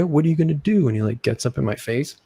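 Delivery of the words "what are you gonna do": higher, louder retold challenge; mock taunt. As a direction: throw it out as a dare. Acting out kid squaring up.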